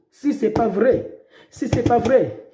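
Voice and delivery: usual speaking voice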